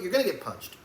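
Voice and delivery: usual speaking voice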